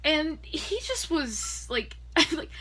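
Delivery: normal talking voice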